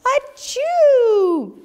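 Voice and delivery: loud, high, drawn-out achoo; big acted sneeze. A narrator's reading. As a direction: explode into a huge story-bear sneeze. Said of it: "He blows and he sneezes".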